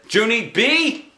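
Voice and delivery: furious call up the stairs; screamed. A narrator's reading. Mother screaming Junie B's name. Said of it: "Mother screamed".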